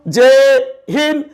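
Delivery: ordinary talk, nothing marked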